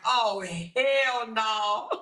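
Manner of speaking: slow, high, drawn out through laughter; laughing. Real, not acted. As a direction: stretch it out while cracking up. Reading chat, laughing.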